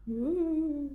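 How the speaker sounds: high spooky ghost wail; hammed-up ghost ooh; ghost moan. Pretend ghost noise.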